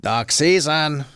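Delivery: slow, drawn-out falling retort; cartoon comeback. Rabbit insisting on the season, slow cartoon comeback. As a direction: stretch both words, insisting.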